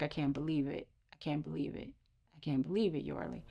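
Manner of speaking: soft, hushed repeated disbelief; fading almost to nothing, overwhelmed; quiet stunned whisper fading out; tearful disbelief. Retold or imitated. Crying in hallway, friend's words.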